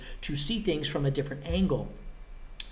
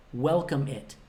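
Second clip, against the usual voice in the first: usual speaking voice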